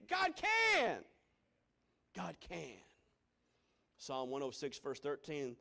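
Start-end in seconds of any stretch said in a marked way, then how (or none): 0.1-1.0 high, loud declaration; ringing climax. Real, not acted. Loud climax then quiet echo. 2.2-2.7 soft, lower repeat; quiet echo. Real, not acted. Soft echo after loud climax.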